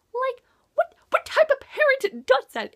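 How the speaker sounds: a reader fuming at a lying parent in the book; outraged. Real, not acted. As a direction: demand it in disbelief at the mother.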